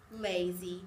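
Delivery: ordinary delivery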